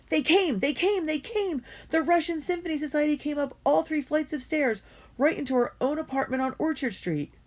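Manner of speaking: can't contain the news; girl writing her diary, overjoyed the orchestra came; bursting with joy. Acted.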